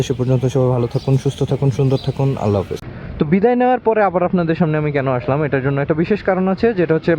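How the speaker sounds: ordinary talk, nothing marked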